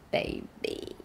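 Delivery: put-on breathy whispered baby; soft whispered baby. Shown as a demonstration. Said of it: "singing demo". (轻声耳语)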